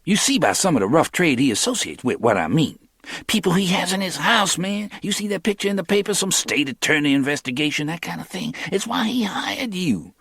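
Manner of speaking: lightly voiced young hustler; Cornell's voice.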